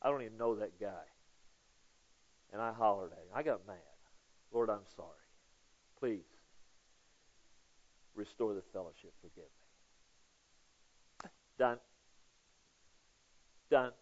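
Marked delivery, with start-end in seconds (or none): 0.0-11.3 low, soft acted apology to God; penitent prayer. Believer asking the Father to restore fellowship, low contrite prayer. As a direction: murmur a contrite confession, low and hushed. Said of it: "come and say".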